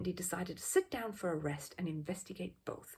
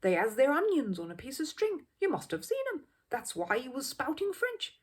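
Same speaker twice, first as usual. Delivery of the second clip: louder, higher rough market voice; know-all Cockney bystander; 6 semitones higher, 5 dB louder than usual. Acted. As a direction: lay down the law to the crowd. Same man, rough Londoner.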